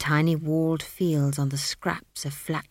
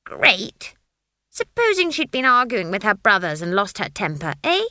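put-on louder, furious old witch; hooting with rage; 2 semitones higher, 6 dB louder than usual. A narrator's reading. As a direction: bellow it back in outrage. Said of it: "Granny hooted with rage".

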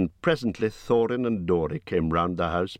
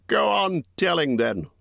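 gruff Bjorn; 11 semitones higher than usual. A narrator's reading. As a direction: voice Bjorn as a blunt giant host. Bjorn voiced by narrator.

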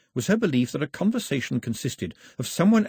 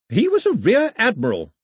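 high, louder sharp retort; snapped; 12 semitones higher, 6 dB louder than usual. Acted. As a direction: snap it back at him. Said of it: "her grandmother snapped".

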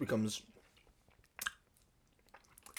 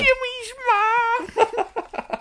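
shrill meme call; 3 dB louder, much slower than usual. Pretend.

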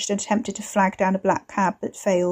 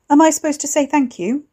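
sarcastic; 7 semitones higher, 5 dB louder than usual. Acted. Wes sarcastic.

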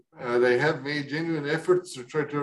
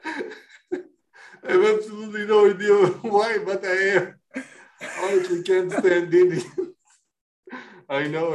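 laughing. Real, not acted. Laughing through the read confession.

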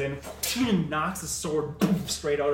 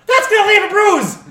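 shrill complaint far higher and louder, laughing; indignant yell; 20 dB louder than usual. Acted. Grim shrieks his complaint, laughing as he yells. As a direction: wail about the bruise.

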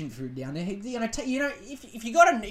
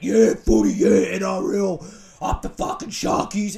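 mock footy bogan; 8 dB louder than usual. Mocking footy fans cheering their team, loud bogan voice. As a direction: bellow it like a beered-up Sharkies supporter. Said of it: "everyone's like".

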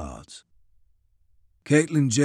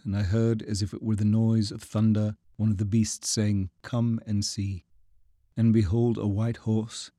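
scripture recitation. Acted. Davies recites Revelation.

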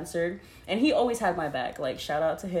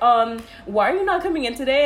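boss; 6 semitones higher, 6 dB louder than usual. Retold or imitated. Boss calling on phone.

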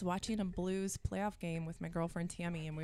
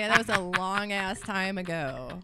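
loud, drawn-out self-defense; emphatic protest; 7 dB louder, slower than usual. Real, not acted. Woman defends herself over her past, emphatic protest.

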